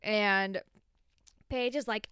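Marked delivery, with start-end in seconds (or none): none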